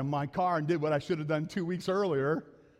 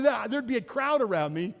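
ordinary talk

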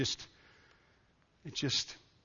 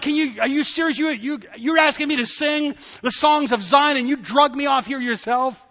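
higher-pitched, indignant questioning; incredulous protest; 8 semitones higher, 6 dB louder than usual. Acted. Captive answering captors who demand songs of Zion, incredulous. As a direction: stammer into an offended challenge.

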